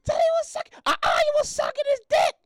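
put-on high shouted child voice; shouting little girl. Daughter insisting loudly in a high child voice. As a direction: yell it like a stubborn kid. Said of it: "It's like".

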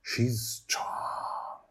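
hushed admiring whisper. Acted. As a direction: say it under your breath. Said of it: "Susie whispers to me".

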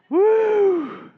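a laugh that breaks into a very high, loud woo; laughing whoop. Real, not acted. Pastor laughing at his own joke, loud whooping laugh. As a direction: let the laugh burst out into a whoop.

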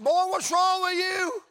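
high, drawn-out incredulous holler; exclaiming father. Retold or imitated. Father to his crying country boy, loud incredulous holler.

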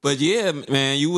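ordinary delivery

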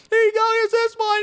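high excited relay shout; hurried handoff call. Retold or imitated. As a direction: juggle the kids along. Passing kids through the cave.

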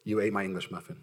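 low, soft mock grievance; sulking. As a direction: act out a long-held sulk. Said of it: "And then there's the sulker".

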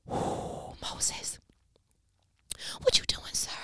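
hushed unvoiced aside; preacher whispering to Moses. Real, not acted. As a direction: lean in and whisper. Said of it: "whispered aside to Moses".

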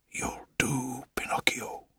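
hushed, breathy whisper of wonder. A narrator's reading. Old carver whispers to his new puppet, hushed and awed. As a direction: whisper it softly, full of awe. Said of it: "he whispered".

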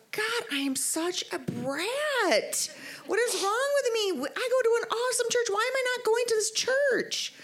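high, slow, exasperated at herself; mock self-scolding. Thinking about skipping church, high exasperated self-scolding. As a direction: berate yourself in a high whiny voice.

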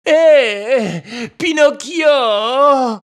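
high, loud, slow whining cry; a beggar fox wailing a high, drawn-out name; whiny call. A narrator's reading. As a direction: call out to beg pity. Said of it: "cria le renard d'une voix pleurnicharde".

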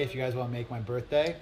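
ordinary talk

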